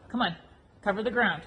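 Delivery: ordinary talk, nothing marked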